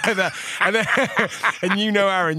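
high, loud, cracking up mid-story; laughing. Real, not acted. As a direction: talk through real laughter. Cracking up at the prank.

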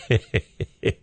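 short breathy chuckle at his own joke. Real, not acted. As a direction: laugh briefly after your punchline. Laughs after his own punchline.

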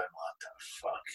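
voiceless, hushed aside; baffled whisper. Retold or imitated. Onlookers looking at the naked man, retold stunned whisper. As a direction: whisper it under your breath in disbelief.

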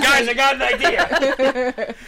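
hyped, slow, laughing; grand reveal, laughing; mock announcement. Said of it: "be like".

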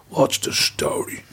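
what's the story muttered low and hushed; barfly muttering a greeting into the pub mirror, hushed. Retold or imitated. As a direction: mutter it down into your chest without turning. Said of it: "He talked right down all the time".

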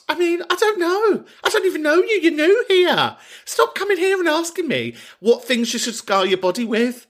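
very high-pitched, indignant protest; high comic squeal of indignation; mock exasperation. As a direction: squeal it in comic outrage at a cheeky stranger. Mock outrage at listener's tattoo question.

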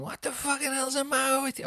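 very high, whiny mock outrage; tipsy Sophie. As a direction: imitate a drunk partner complaining in falsetto. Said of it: "she's had a drink".

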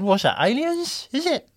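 slow, rising to a high question; hammed-up innocent question; mock naive. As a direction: play dumb about it.

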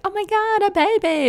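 put-on high, loud gush over a baby; cooing delight. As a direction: coo like you've just spotted a baby. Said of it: "Most people are like".

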